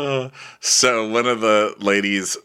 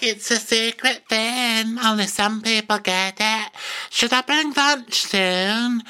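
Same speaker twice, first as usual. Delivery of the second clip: much higher, far-off put-on voice; mock ditzy high voice; airy guest; 14 semitones higher, slower than usual. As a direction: float it out dreamily, slowing at the end. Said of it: "she's like".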